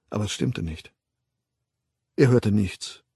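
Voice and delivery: ordinary delivery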